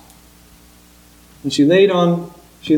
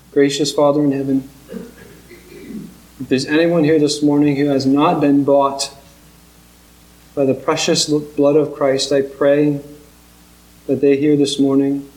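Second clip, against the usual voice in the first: earnest pastoral prayer, steady and measured; closing prayer; 3 semitones lower than usual. Real, not acted. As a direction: pray over the congregation, sincere and unhurried.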